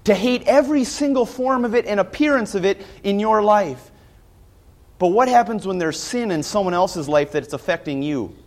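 normal talking voice

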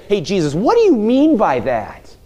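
a lightly voiced, puzzled Peter, going high on the last line; confused Peter. Preacher voicing Peter questioning Jesus, lightly puzzled. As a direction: play Peter lost and asking Jesus to explain. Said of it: "Peter standing and say".

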